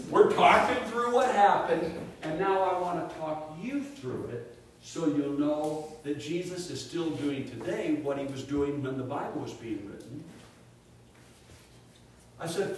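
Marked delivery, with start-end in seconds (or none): none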